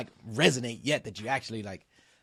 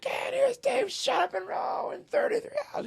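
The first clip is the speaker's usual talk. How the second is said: much higher, booming announcer shout; announcer call. Retold or imitated. Announcer welcoming the boat home, high booming call.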